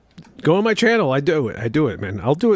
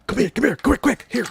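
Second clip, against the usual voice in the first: high-pitched hurried summons at normal volume; high, urgent mock stage call; urgent beckoning; 12 semitones higher than usual. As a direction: re-enact calling someone over in a rush. Acts out urgently beckoning his double over.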